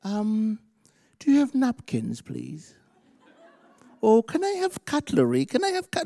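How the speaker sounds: high, hesitant little voice; fussy polite guest. Acted. Hungry guest asking rich host for cutlery, dainty. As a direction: ask daintily in a high, put-on voice. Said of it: "never seen that hungry man go".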